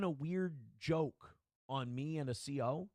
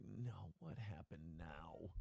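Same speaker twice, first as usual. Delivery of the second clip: much lower, softer and slow, heart in throat; retold dread; 11 semitones lower, 14 dB quieter, much slower than usual. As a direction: murmur it in sinking fear. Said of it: "That one, I was like".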